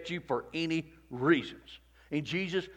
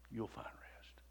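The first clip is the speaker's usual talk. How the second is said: whisper; 3 semitones lower, 19 dB quieter, slower than usual. Acted. Whispered repeat.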